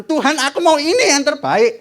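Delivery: put-on demanding child. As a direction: plead like a spoiled child to God. Believer demanding from God.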